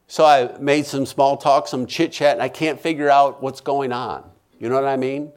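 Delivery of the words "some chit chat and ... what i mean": ordinary talk, nothing marked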